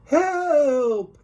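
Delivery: high shouted call for help; cry for help. Acted. Gym teacher under attack, high cry for help. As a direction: yelp it out in alarm.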